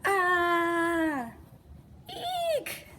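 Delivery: high startled squeal; frightened shriek. Acted. Scared kid squealing at a raindrop.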